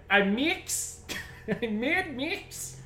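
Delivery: high, drawn-out bad Aussie twang; botched Australian accent. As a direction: overdo a terrible Australian accent. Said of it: "Doing Australian".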